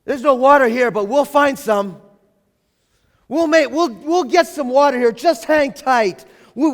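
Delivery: loud, upbeat rallying of the group; cool-headed organizer. Retold or imitated. Calm leader rallying people to dig for water. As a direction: take charge and reassure everyone. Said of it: "might have a cool head".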